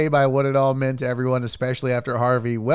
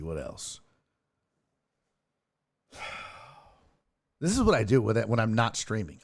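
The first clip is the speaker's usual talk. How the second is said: normal talking voice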